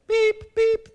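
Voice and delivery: high electronic beeping; alarm beep imitation.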